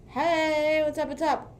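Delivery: quick chirpy hello to the stream; sing-song greeting. Pretend. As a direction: perk up and greet the viewers playfully.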